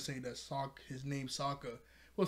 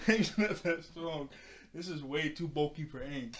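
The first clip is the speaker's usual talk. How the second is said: mock voice, slowed. Shown as a demonstration. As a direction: drag it out in a goofy character voice. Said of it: "repeated mock delivery".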